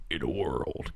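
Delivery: low, hushed trailer voice-over; deep, soft "In a world" guy; movie-trailer announcer. Acted. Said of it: "They should have gotten the".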